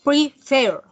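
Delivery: "Prefer" loud and split, landing on FER; stress on last syllable. Shown as a demonstration. Teacher proving where the accent falls, "Prefer" stressed on FER. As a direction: punch the final syllable of "Prefer". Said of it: "tiene el acento en la última".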